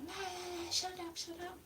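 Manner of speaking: high, hushed mock shouting; imitating shouty neighbours. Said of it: "somebody shouting at me".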